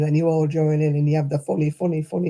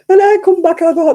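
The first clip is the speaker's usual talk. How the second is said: much higher, louder laughing burst; real laughter; 13 semitones higher, 11 dB louder than usual. Real, not acted. Laughing at drunk story.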